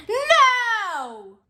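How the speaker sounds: high, drawn-out no; exaggerated cry of dismay; exclaimed dismay. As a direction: wail it in mock dismay, starting high and sliding down. Exclaims as the payment fails.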